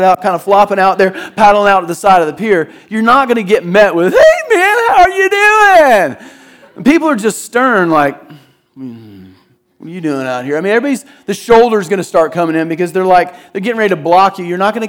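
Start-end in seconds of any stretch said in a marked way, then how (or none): 4.1-6.2 high, bright mock greeting, drawn out; friendly surfer greeting. Pastor imitating a friendly surfer greeting a newcomer, high and hammed-up. 8.7-10.6 low, quiet, suspicious mock local; stern local. Pastor voicing a stern local surfer, low and suspicious.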